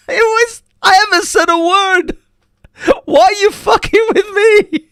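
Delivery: retold quote squealed through real laughter; very high, almost crying with laughter; helpless laughter. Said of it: "I couldn't stop laughing".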